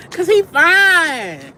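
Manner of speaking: slow, high, loud drawn-out swoon. Real, not acted. Telling her viewers how fine he is, swooning slow and high. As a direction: stretch it out like she's melting.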